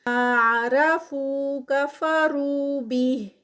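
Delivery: slow, melodic chanted recitation; Quran recitation. Acted. Teacher reciting the whole passage for students, slow and melodic. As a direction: draw each phrase out in measured chant.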